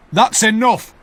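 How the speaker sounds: stern sergeant. Acted. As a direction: cut the argument off firmly. Sergeant stopping the quarrel.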